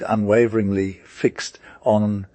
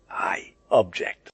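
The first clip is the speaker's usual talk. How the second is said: breathless whispered aside, slower; a secret murmured objection; 4 dB quieter, slower than usual. Acted. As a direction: hush it right down to a whisper. Aside in a story dialogue.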